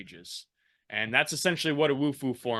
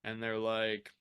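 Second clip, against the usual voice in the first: ordinary delivery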